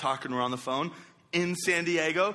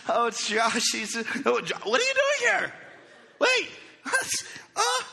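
put-on excited girlfriend; 10 semitones higher than usual. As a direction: mimic her shocked squeal on the phone. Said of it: "She's like".

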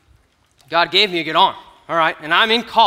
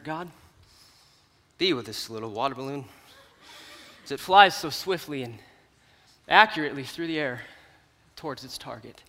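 mock solemn prayer; 7 semitones lower, 8 dB quieter than usual. To God before the throw, a hushed mock-solemn prayer. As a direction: pray over the balloon like it's sacred. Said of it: "I say my last little prayer".

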